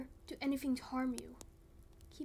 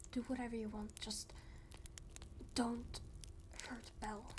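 hushed, desperate plea; pleading; 8 dB quieter than usual. Acted. Father begs the kidnapper to spare Belle, hushed plea. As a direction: beg them quietly to spare her.

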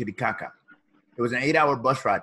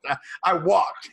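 laughing; 5 semitones higher, 5 dB louder, slower than usual. Real, not acted. Joking punchline to son.

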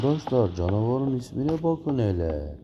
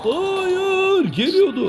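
high frightened yell; panicked shout; much slower than usual. Real, not acted. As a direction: scream as it rushes by. Monster rushes past.

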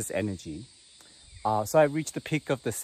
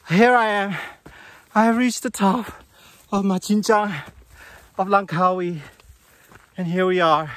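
triumphant; 7 semitones higher, 6 dB louder than usual. Real, not acted. Triumphant arrival at the peak.

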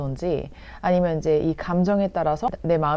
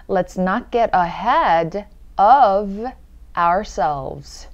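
slow and loud, drawing out the small words; slow demo; 4 dB louder, much slower than usual. Shown as a demonstration. Teacher having learners repeat, slow demo. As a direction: spell it out slowly for repeating. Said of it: "천천히 한번 볼게요".

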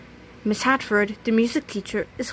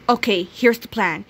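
ordinary delivery, nothing marked

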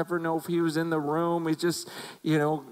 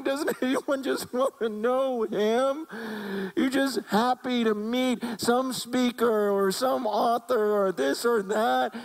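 high, drawn-out plea imitating a crying pastor; retold tearful plea; 8 semitones higher, 3 dB louder than usual. Retelling a crying pastor's appeal, imitated anguished pleading. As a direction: beg in his place, high and slow. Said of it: "and he's crying".

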